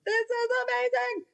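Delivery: high squeaky dog, over the moon; giddy dog voice. Retold or imitated. Trainer keeps voicing the thrilled dog, high and squeaky.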